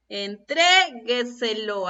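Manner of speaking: Entrégueselo slow, with a long "tré"; stress on TRE. Shown as a demonstration. Teacher gives the next example, "tré" held in Entrégueselo. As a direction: stretch and lean on "tré" in Entrégueselo. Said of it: "En la sílaba tre".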